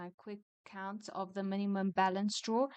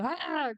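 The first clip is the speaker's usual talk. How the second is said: mock scream; 7 semitones higher, 6 dB louder than usual. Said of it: "I don't wanna go all".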